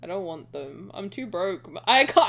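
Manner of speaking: ordinary delivery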